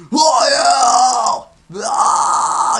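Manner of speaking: loud, high, raspy screamed bursts; stronger scream. Shown as a demonstration. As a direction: scream each word with full push. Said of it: "when you get more".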